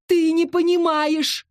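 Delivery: high drawn-out wail. A narrator's reading. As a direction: wail it slowly, begging. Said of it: "воет Стэн".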